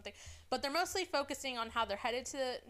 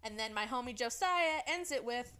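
normal talking voice

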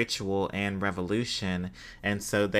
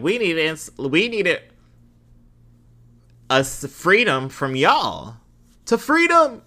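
much higher and louder, a hammed-up cry to France; mock cry for freedom; 9 semitones higher, 9 dB louder than usual. Vlogger voicing enslaved Haitians at France, hammed-up high shout.

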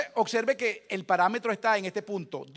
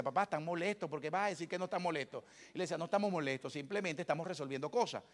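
softer, low-key back and forth; hushed exchange; 3 semitones lower, 8 dB quieter than usual. Retold or imitated. Father and son argue quietly about the parents fighting, soft. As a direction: keep it down so the kids don't hear.

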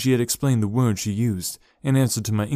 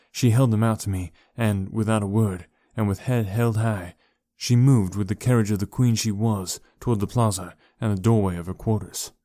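earnest audiobook narration; story narration. A narrator's reading. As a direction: read it like a romantic classic. Long narrated reflection.

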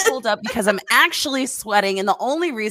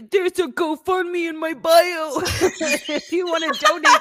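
mock Spider-Man pitch; 6 semitones higher, 5 dB louder than usual. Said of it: "he's like".

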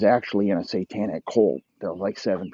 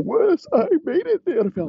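high, loud, fast incredulous squeal; high loud disbelieving squeak; shocked disbelief; 6 dB louder, faster than usual. Acted. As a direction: fire it back in high shock. Teddy's shocked retort.

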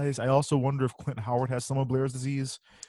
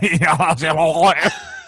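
big burst of laughter; cracking up loudly; 3 semitones higher, 8 dB louder than usual. Real, not acted. Laughing at his impression.

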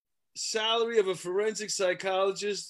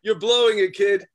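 louder, pushing parent lecturing a failing son; mock parental warning, raised voice; stern parent scolding; 3 semitones higher, 7 dB louder than usual. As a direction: lay down the law like a disappointed dad, without the accent. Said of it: "I don't wanna do the accent".

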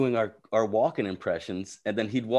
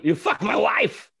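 slow, louder accusing shout; hammed-up accusation; mock accusation; 6 semitones higher, 5 dB louder, slower than usual. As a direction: bark it like a jealous husband. Punchline repeated.

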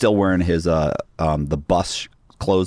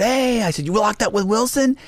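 much higher, eager surprised voice; surprised high question. Retold or imitated. To a former cellmate, asking about Wilson in high surprise. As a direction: pitch it way up like you can't believe it.

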